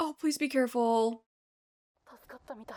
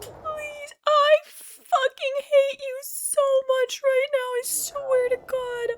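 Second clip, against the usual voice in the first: crying anger; 12 semitones higher, 3 dB louder than usual. Real, not acted. Crying anger at game character.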